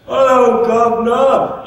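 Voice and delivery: hammed-up, high-pitched fake British greeting; terrible British accent. YouTuber demonstrating a supposedly perfect British accent, badly faked British. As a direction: do an awful over-the-top British accent, drawn out at the end. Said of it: "it's a terrible accent".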